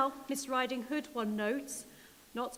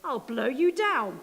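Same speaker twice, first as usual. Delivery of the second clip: louder threatening wolf; wolf threat; 7 dB louder than usual. Acted. Wolf threatening the third pig, louder. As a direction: bark it at the brick house. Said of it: "the wolf replied".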